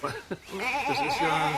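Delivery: high, slow, drawn-out gasp of amazement; astonished. Acted. Peter staring at the neighbour's sheep, slow high astonishment. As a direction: stretch it out in sheer disbelief.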